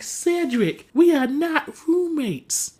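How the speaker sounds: high, slow, emphatic denial; retold exasperated refusal. Steve telling Cedric they're not roommates, exasperated refusal.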